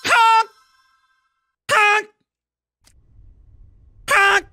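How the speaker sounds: high loud goose honk. Pretend. Honking loudly in game.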